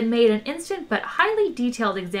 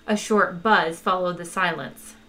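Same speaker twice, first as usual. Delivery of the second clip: plain reading voice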